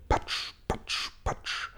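whispered patsch, patsch, patsch like hail in fruit; soft splat sounds. A narrator's reading. Narrator mimics hail hitting the peach, soft voiceless patsch. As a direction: breathe out patsch, patsch, patsch softly. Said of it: "hail sound effect".

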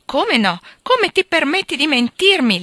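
high, loud furious yell; angry shout. A narrator's reading. Drunk husband yells at his wife for lying, loud and high. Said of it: "le ha gridato".

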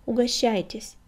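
ordinary delivery, nothing marked

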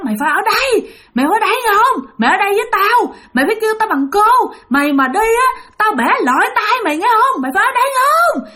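high-pitched threatening shriek; screaming ghost woman. Retold or imitated. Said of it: "Bắt đầu la làng lên". (giọng bà ma la làng, the thé đe dọa)

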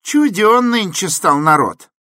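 very high, loud woman's voice; peasant woman. A narrator's reading. Peasant woman comments on folk nowadays, high and loud. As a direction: lament loudly in a high voice. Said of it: "сказала баба".

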